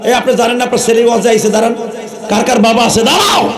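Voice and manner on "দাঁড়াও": sudden shout. Real, not acted. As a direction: shout it across the crowd. Preacher calls fathers to stand.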